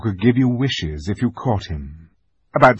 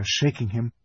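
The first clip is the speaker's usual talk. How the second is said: usual speaking voice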